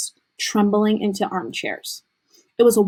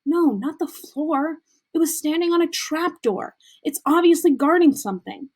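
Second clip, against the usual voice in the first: impatient Hermione; 7 semitones higher than usual. Acted. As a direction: explain it superiorly. Hermione correcting them.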